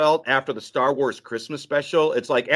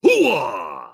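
lower, loud Pacino bark of hooah; 3 semitones lower, 4 dB louder than usual. Pretend. Asked by his co-host for his best Al Pacino, a loud hooah. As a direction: belt out a gruff Pacino hooah. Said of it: "Could I hear your best Al Pacino?".

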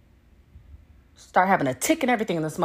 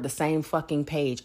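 usual speaking voice

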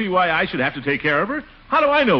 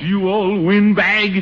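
high, slow indignant jeer; outraged name-calling; angry insult; 5 semitones higher, slower than usual. Acted. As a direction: hurl the insult, drawing it out. Acted trading of insults.

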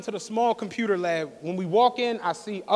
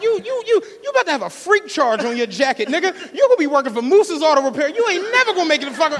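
high, fast, loud roasting rant; going off on him, fast and loud; mock fury; 5 semitones higher, 4 dB louder, faster than usual. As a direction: tear into him, faster and faster. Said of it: "I was going crazy".

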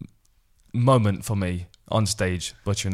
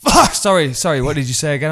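high loud yelp then hurried sorry; mock customer-call curse outburst; blurted curse then apology; 4 semitones higher, 7 dB louder than usual.

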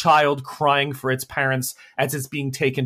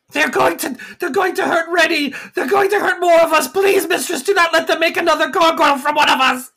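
very high, loud panicked shout; panicked gargoyle; 4 dB louder than usual. Acted. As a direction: scream a warning in terror. Rex panicking.

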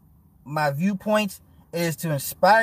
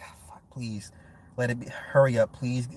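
hushed, pleading under his breath; desperate whisper; 8 semitones lower, 6 dB quieter than usual. Retold or imitated. Son begging his scolding father to finish, whispered. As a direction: whisper a plea for the lecture to end. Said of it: "I'll just be like".

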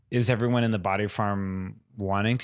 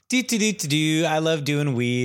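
hammed-up high stoner sing-song; silly high mock local; goofy stoner; 7 semitones higher than usual. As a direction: play a blissed-out Amsterdam pothead, humming first. Mocking Amsterdam locals.

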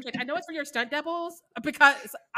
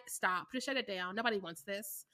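mock studio dismissal; 6 semitones lower, 11 dB quieter than usual. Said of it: "the studio was like".

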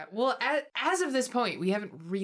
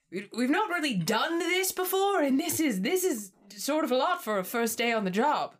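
higher, fretful polite character voice; flustered and overly polite; a lightly voiced nervous newcomer; Helvetica's voice; 7 semitones higher, 3 dB louder than usual.

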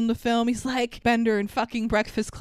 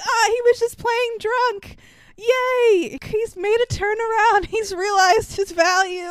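high, loud mock celebration; mock cheer; 11 semitones higher, 7 dB louder than usual. Viewer fakes delight at a drunk dad's turnaround, loudly. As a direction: cheer with exaggerated joy. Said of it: "You're like".

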